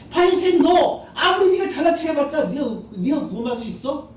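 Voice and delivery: high, loud scolding of a proud man; rebuking shout. Acted. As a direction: call him out sharply. Jesus rebuking the Pharisee. (높고 큰 소리로 꾸짖듯이)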